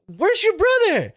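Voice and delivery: slow, high put-on voice of the chill sister; high mock sister voice. The chill sister asking where her brother is, slow high voice.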